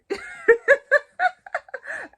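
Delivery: big high cackle of laughter; real full laugh at her own joke; loud burst of laughter. Real, not acted.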